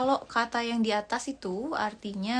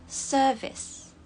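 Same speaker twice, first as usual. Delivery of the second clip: British "service" with no r; British accent; much slower than usual. Shown as a demonstration. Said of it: "Kalau British". (logat British)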